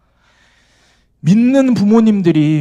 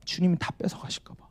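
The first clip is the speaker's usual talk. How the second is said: breathy near-silent whisper; hushed dread; whispered fear; 12 dB quieter than usual. Real, not acted. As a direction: let the fear slip out in a whisper. Voicing fear.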